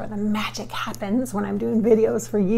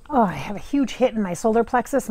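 normal talking voice

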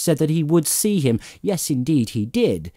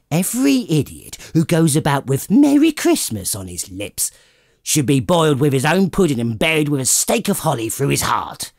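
louder, fast and indignant; 3 semitones higher, 3 dB louder than usual. Acted. Scrooge railing at his nephew, indignant and fast. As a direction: rant at every holiday fool. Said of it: "said Scrooge indignantly".

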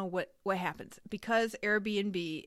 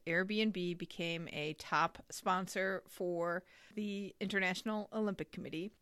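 ordinary talk, nothing marked

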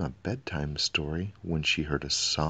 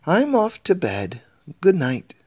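much higher, louder helpful servant voice; eager treasure keeper; 12 semitones higher, 6 dB louder than usual. A narrator's reading. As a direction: chirp it up as a keen palace servant.

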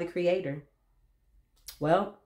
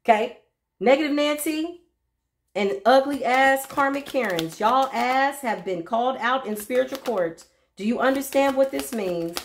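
higher, defiant, calling them out; sassy call-out; 6 semitones higher than usual. Real, not acted. A reader scolding her Negative Nancy enemies, sassy and raised. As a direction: read the haters with sass.

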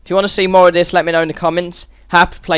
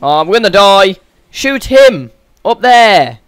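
loud, raised, exasperated yell; frantic shouting; 2 semitones higher, 7 dB louder, slower than usual. Real, not acted. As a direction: shout desperate orders at your teammate. Panicked orders to partner in game.

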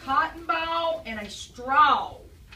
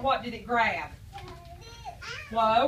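normal talking voice

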